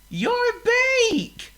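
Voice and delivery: a pig eager to explain; thrilled pig voice; excited pig. Acted. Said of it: "called Oink".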